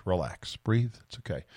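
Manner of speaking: soft, low calming self-talk; self-soothing. Pretend. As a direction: talk yourself down, quietly. Talks himself down from brain lock.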